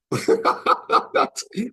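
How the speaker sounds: loud, high staccato cackle; hearty laugh. Real, not acted. Man amused by half-drunk water bottles, hearty laugh. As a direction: burst out in rapid ha-ha laughter. Laughing at his own observation.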